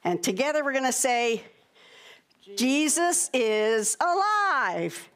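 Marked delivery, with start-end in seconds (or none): 2.5-5.1 very slow, drawn-out announcement; full-voiced proclamation. Shown as a demonstration. Telling the people together with the children, loud slow proclamation. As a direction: proclaim it with long stretched words. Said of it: "we were whispering and not talking too loud".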